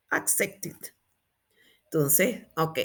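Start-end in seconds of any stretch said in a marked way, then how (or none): 0.1-0.8 ACCEPTED softly, "ak-sep-tit". Shown as a demonstration. Teacher modelling the past, -ed as "it". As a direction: add "it" to the end of ACCEPTED. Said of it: "pronunciamos it".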